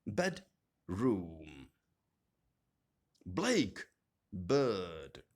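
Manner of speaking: first part stressed in "bedroom", "blackbird", "check in", "greenhouse", "make up", "water bottle". Shown as a demonstration. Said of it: "first part of the word".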